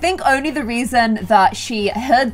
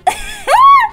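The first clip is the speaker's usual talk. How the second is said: very high loud squeal of laughter; shrieking laugh; 6 dB louder than usual. Real, not acted. Laughing at human feet.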